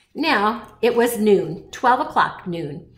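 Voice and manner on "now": ordinary delivery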